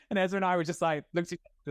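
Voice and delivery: normal talking voice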